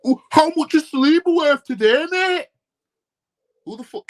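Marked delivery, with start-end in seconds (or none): 0.0-2.5 high, loud whiny fan impression; mocking fan voice. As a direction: ham up a clueless fan asking a silly question. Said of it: "they just keep talking like this".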